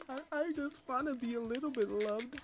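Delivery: soft, plaintive put-on voice; mock self-pity. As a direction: plead softly like someone unloved. Mock self-pity after insult.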